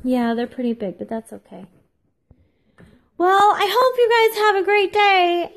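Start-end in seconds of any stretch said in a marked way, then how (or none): 3.2-5.5 high, loud sing-song farewell; overdone high-pitched send-off; forced cheery sign-off. Real, not acted. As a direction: push the goodbye up high. Forced sign-off while upset.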